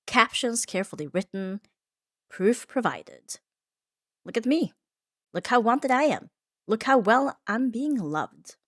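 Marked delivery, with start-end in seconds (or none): 4.2-8.6 high, loud, preening boast that slows to a drag at the end; sneering parody of a couple bragging about being loved; mocking show-off. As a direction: lay the smug showing-off on thick to mock it.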